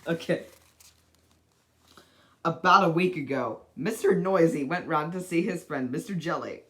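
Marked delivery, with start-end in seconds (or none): none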